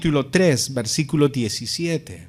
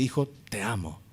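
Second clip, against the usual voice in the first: softer, tender "Hijo, te amo" from a father; sweet fatherly voice; 5 semitones lower, 9 dB quieter than usual. Retold or imitated. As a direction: say it gently, like a loving dad to his son. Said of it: "cuán dulce puede sonar eso". (padre diciéndole al hijo con dulzura y en voz suave)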